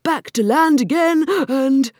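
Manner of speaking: high, loud creature holler; wildly excited yelling; excited shout. A narrator's reading. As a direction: shout it breaking off at the peak.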